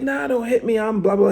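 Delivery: high, scared pleading voice; mock pleading. Pinned kid begging not to be hit, high scared plea.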